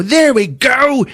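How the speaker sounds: high, drawn-out effortful call; mock exertion building to a triumphant cry; straining dad.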